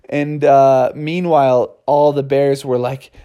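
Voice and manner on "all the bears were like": usual speaking voice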